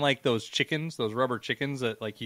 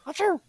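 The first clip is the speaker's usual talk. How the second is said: very high little mock sneeze; a tiny squeaked achoo; squeaky sneeze. Host imitating his penis sneezing, very high squeaky achoo. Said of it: "it'd be like".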